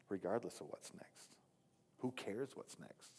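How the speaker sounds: soft whisper, slowing down; preacher whispering the point to the congregation; whispered emphasis. Real, not acted. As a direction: drop to a whisper to drive it home. Sermon rhetorical hush.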